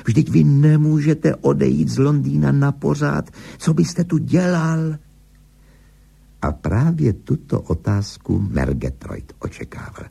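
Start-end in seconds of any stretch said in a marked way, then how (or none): 0.0-5.0 high, insistent protest; objecting. A narrator's reading. Higgins argues against the director leaving London, protesting. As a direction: object in a raised, pleading pitch. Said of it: "namítl Higgins".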